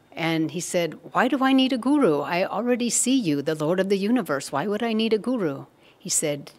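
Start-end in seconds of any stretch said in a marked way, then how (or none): 1.1-5.7 high, insistent objection; protesting. Retold or imitated. Disciple questions Krishna's order to find a guru, protesting. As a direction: argue back in a raised, emphatic voice.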